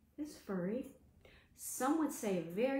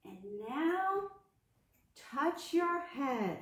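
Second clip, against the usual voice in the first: singsong game call; 6 semitones higher, much slower than usual. Acted. Teacher leading Simon Says game.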